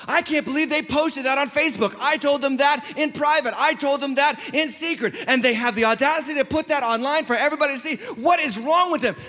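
high-pitched betrayed complaint; dramatized exasperated outrage; acted indignant disbelief. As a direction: protest like someone whose trust was broken. Voices someone betrayed on Facebook.

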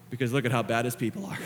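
soft, low, ending in a chuckle; humbled wonder. Acted. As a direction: say it low and gently amused. Same words turned into grateful wonder.

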